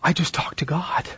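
whispered amazement; child whispering in wonder; awed whisper. Retold or imitated. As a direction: hush it like a kid amazed. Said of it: "And they'll go".

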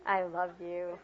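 flat throwaway sarcasm; sarcastic. Shown as a demonstration. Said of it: "Sarcastic to me is like".

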